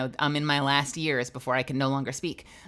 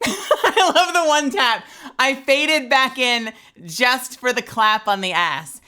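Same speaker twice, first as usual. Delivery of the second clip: laughing; 10 semitones higher, 8 dB louder, slower than usual. Real, not acted. Watching her old performance, laughing.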